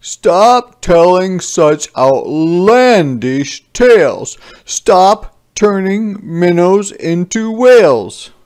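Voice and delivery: slow, loud stern scolding; father scolding his son for tall tales, sternly and loudly; stern dad. A narrator's reading. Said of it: "he looks at me and sternly says".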